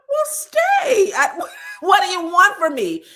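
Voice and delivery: high, loud, laughing mock yell; mock shout. Husband yelling back at wife, hammed-up high shout. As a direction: bellow it like the fed-up husband. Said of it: "And he went".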